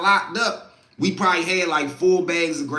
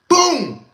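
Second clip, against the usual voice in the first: sharp high shouted boom, like a fist on a cell door; loud bang imitation; 9 semitones higher, 6 dB louder than usual. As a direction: slam the word out as a door bang. Door-banging in cell block.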